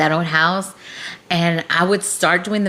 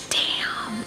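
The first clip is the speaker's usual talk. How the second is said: whispered damn; 7 dB quieter than usual. Retold or imitated. Stunned at neighbor moving out.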